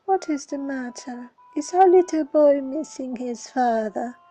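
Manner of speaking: mocking, taunting tone; sarcastic. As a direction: needle him about his father. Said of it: "says the child sarcastically".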